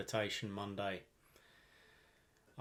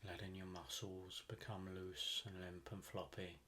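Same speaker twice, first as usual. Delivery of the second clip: soft, unhurried meditation voice with long pauses; calm, even delivery, leaving space between phrases; guided meditation; 3 semitones lower, 10 dB quieter than usual. Acted. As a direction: guide the listener gently into deep relaxation.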